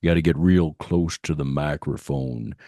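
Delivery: low, burly voice close to the microphone; Sam Elliott impression. Host renaming his podcast as a joke, burly Sam Elliott impression. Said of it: "Another fun one to do is Sam Elliott".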